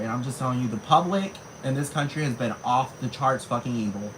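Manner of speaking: ordinary talk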